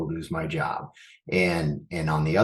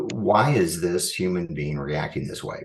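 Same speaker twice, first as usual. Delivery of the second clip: normal talking voice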